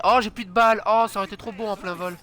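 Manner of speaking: ordinary talk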